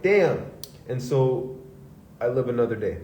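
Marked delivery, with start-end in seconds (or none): 0.0-0.5 sharp high exclamation; damn. Retold or imitated. Retelling his reaction to her joke, a surprised damn. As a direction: blurt it out in surprise. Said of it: "And I'm like".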